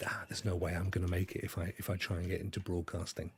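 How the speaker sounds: soft, low, quick thinking-to-oneself; voiced inner thought.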